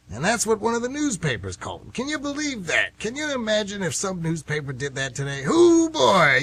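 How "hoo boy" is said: excited exclamation. Real, not acted. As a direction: burst out in disbelief. Amazed exclamation.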